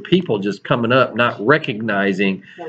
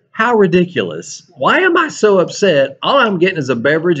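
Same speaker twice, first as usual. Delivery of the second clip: upset inner rant; 7 semitones higher than usual. Retold or imitated. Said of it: "Now I'm really mad".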